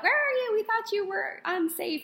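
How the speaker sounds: high, hammed-up worried friend voice; mock worried friends. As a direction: squeak it out like anxious friends checking in. Friends voiced calling her.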